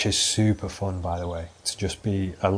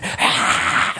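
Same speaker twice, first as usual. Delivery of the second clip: wild scream; 4 semitones lower than usual. Retold or imitated. Friends losing their minds at the pool, wild scream. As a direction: let out a mad yell.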